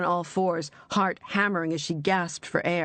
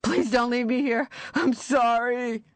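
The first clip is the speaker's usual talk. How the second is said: higher and louder, slowing into the apology; frantic pleading; 7 semitones higher, 3 dB louder than usual. Acted. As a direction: beg not to be left. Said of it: "Walter screamed".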